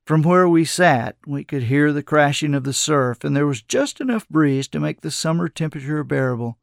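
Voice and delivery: ordinary delivery, nothing marked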